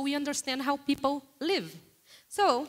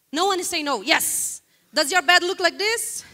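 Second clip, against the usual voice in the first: teasing call out; 5 semitones higher, 8 dB louder than usual. Real, not acted. Teasing the audience.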